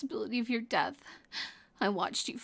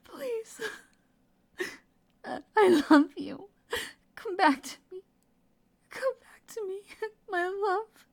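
tearful pleading; 3 semitones higher, 5 dB louder than usual. Acted. Begging a wounded lover to wake up, crying. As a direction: plead through tears, voice climbing high.

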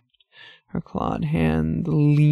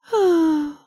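high theatrical sigh; dramatic sigh; 14 semitones higher, 5 dB louder than usual. Acted. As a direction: let out a long bored sigh. Demoness sighs theatrically.

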